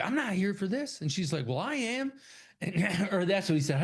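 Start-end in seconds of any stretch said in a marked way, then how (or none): 0.0-2.1 higher, rising put-on voices; mock retort. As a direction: toss the lines back and forth in silly high voices.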